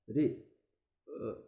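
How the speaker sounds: normal talking voice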